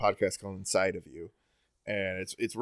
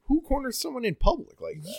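indignant question pitched well up. Real, not acted. Talking about the cheer mom, an incredulous high question. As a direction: ask it in disbelief, high and incredulous.

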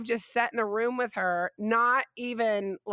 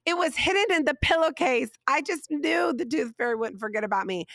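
put-on excited child; 6 semitones higher than usual. Daughter telling mom she found the tooth money, high and delighted. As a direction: gush like a thrilled little girl. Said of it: "she was like".

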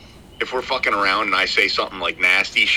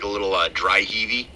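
ordinary talk, nothing marked

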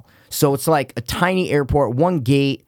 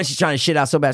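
ordinary talk, nothing marked

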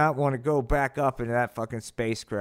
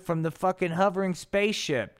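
plain reading voice

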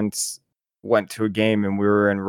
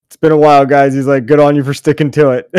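louder mock player's reply; raised-voice retort; 3 semitones higher, 7 dB louder than usual. Player answering persistent hecklers, raised imitated voice. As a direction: call it back up to the hecklers.

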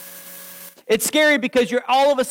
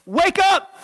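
"wake up" jumping higher, insistent; urgent wake-up call; 9 semitones higher than usual. Retold or imitated. God rousing Peter, a higher insistent "wake up". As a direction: push the second "wake up" up high. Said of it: "I love you enough, Peter, to say".

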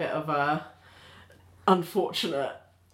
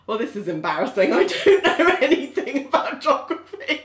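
laughing, high embarrassed confession; a laughing put-on of an awkward tourist; embarrassed quote; 6 semitones higher, 5 dB louder than usual. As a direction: sheepishly own up through a laugh. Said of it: "they were just like".

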